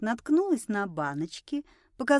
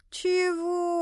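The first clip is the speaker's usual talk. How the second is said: outraged chorus; 9 semitones higher than usual. A narrator's reading. Ladies answering a drunk's loan request, outraged. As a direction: question it in high-pitched outrage. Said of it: "хором рявкнули дамы".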